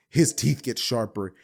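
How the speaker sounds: usual speaking voice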